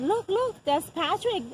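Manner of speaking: high-pitched, urgent retold cry of recognition; excited exclamation. Mother alerting staff that her son is responding, excited. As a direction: call out high, pointing out a sign of life.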